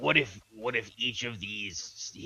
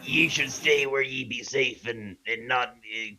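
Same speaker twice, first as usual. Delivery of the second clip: put-on pirate accent; 5 semitones higher, 13 dB louder than usual. Pirate speech aye ye.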